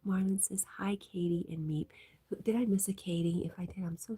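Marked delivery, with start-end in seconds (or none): 0.1-1.9 hushed ASMR-like murmur; whisper. Real, not acted. Streamer whispers chat messages to viewers.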